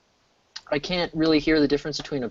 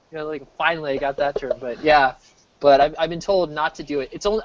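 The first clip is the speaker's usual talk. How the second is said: normal talking voice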